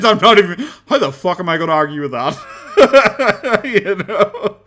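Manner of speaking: high, loud laughter through words; real giggling fit; laughing hard. Real, not acted. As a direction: laugh it off, almost breathless. Laughing at the troll.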